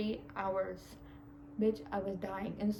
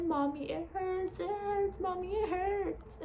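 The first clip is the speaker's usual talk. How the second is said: high, slow whimpering plea to mom; high, slow, childlike mommy it hurts; childlike pleading; 10 semitones higher, slower than usual. Retold or imitated. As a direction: cry for your mommy like a little kid. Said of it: "I was like".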